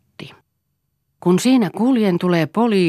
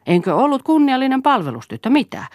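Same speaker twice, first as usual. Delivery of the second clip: high, louder indignant retort; indignant challenge; 11 semitones higher, 5 dB louder than usual. A narrator's reading. Woman defending her honour to her accuser, indignant. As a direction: throw it back as a heated, high-pitched challenge. Acted quarrel, Siiri defends herself.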